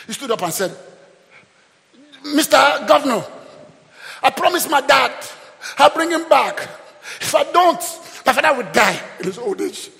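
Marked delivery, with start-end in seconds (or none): 2.2-9.9 high, loud, phrase-by-phrase appeal; impassioned plea. Acted. Judah standing up before the governor for his brother, impassioned plea. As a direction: plead Judah's case at full voice.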